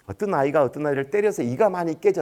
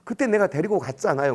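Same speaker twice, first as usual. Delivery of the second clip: normal talking voice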